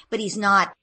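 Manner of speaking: usual speaking voice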